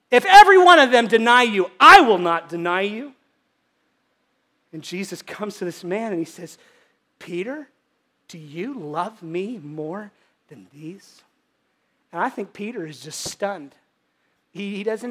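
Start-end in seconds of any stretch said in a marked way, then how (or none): 0.1-3.1 bold declaration. Retold or imitated. Peter's bold declaration retold. 7.2-11.2 quiet and slow, gently probing; soft, slow question. Shown as a demonstration. Jesus quietly asking Peter, soft and slow. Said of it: "the tone that he said it".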